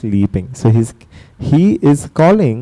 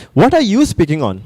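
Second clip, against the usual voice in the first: normal talking voice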